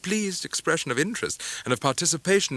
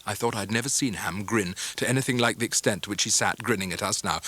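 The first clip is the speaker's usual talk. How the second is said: usual speaking voice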